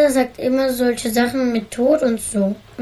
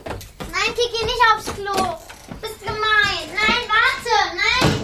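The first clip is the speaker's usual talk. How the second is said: high, whining protest; pleading whine; 9 semitones higher than usual. Acted. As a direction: beg her not to go. Little sister begs sister to wait. (Kind bettelt, die Schwester soll warten, hoch und quengelig)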